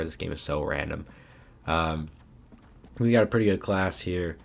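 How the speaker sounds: normal talking voice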